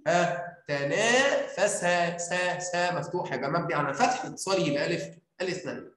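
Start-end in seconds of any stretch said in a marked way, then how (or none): 0.1-2.9 slow demo pronunciation. Shown as a demonstration. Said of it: "شوف أنت نطقته إزاي؟".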